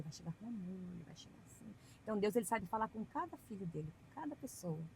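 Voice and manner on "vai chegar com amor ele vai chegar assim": breathy whisper with no voice; hushed demo of a gentle approach. Shown as a demonstration. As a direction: whisper it softly, as God comes gently. Showing how God comes gently.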